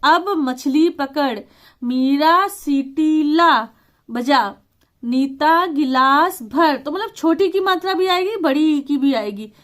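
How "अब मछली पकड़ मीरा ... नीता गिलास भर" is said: words laid out very slowly, one by one; slow dictation. Shown as a demonstration. Teacher reading worksheet sentences aloud, slowly. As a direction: read each sentence as if a child copies it. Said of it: "चित्र देखकर वाक्य पूरा करो".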